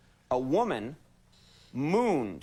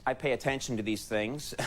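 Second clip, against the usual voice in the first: usual speaking voice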